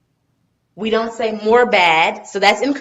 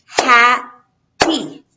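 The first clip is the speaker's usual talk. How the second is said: "happy" split as HAP, pause, PY, louder; two separate syllables; 3 semitones higher, 5 dB louder than usual. Shown as a demonstration. Teacher counts syllables for students, "happy" in two separate loud beats. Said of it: "two syllables".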